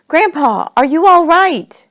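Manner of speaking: high, loud call; worried calling out. A narrator's reading. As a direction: call down to someone far below. Dad calls down to fallen grandpa.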